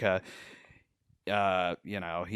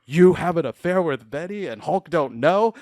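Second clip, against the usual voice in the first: loud, raised-pitch caveman outrage; angry Hulk; 8 semitones higher, 8 dB louder than usual. Retold or imitated. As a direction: roar the accusation like a jealous monster. Acted angry Hulk confronting rival.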